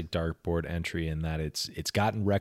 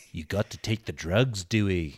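lightly voiced Liverpool accent. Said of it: "those impressions of the Beatles from Walk Hard".

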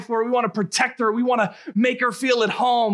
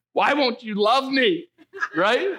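mock whine; 5 semitones higher, slower than usual. Mock plea to the teenager.

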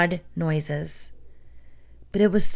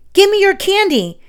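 much higher, louder order; urgent demand; 12 semitones higher, 5 dB louder than usual. A narrator's reading. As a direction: snap it at your friend. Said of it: "he demanded of Glenn".

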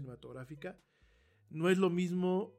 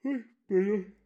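talking through a yawn; 4 semitones higher, 8 dB louder than usual. Real, not acted. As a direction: yawn breaking into words. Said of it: "no se bosteza al aire".

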